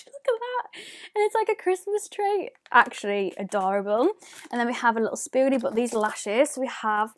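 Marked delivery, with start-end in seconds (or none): 0.1-2.5 high cooing over something cute. Real, not acted. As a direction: coo at it like a tiny treasure. Cooing over tiny sponge.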